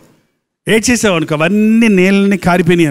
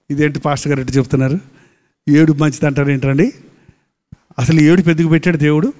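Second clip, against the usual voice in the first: lower, quicker mock objection; puzzled objector; 6 semitones lower, faster than usual. Preacher mimics listeners questioning his praise of weeping, puzzled objection. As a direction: lightly imitate a doubting listener's questions.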